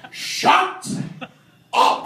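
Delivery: drawn-out high, loud shout; angry brother. Retold or imitated. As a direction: imitate a sulking boy's outburst. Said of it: "And he'd be like".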